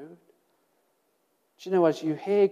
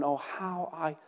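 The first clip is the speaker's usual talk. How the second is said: tender reading; 3 semitones lower, 8 dB quieter, slower than usual. Acted. Reading the psalm verse.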